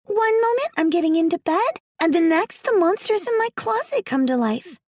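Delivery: put-on high child voice, a bit weary; little girl Alice. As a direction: speak as a young girl telling her story.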